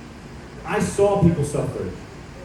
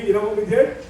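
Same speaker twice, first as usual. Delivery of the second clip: ordinary delivery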